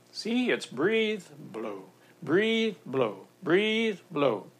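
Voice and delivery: slow, rhythmic breathing instruction; acted swim coach pacing the breaths; swimming demonstrator. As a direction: draw out each "breathe" and "blow". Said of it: "the boater jumps in and says".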